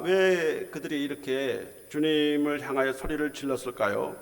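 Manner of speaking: normal talking voice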